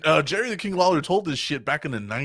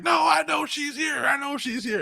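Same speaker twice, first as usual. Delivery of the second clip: much higher, stubborn yell; husband yelling back at the wrestlers, much higher; mock shouting husband; 14 semitones higher than usual.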